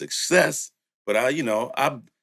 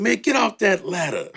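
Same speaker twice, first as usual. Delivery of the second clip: high mock yell; retold yell; 12 semitones higher, 3 dB louder than usual. Producer telling Master P to get off the ladder, high mock yell. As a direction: call him down off the ladder, half laughing. Seeing the botched paint job.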